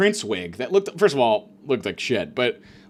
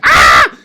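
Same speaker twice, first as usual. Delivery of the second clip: sudden mock shout "Ah"; mock yell; 12 semitones lower, 15 dB louder than usual. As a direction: blurt a loud sharp yell. Train beggar noise.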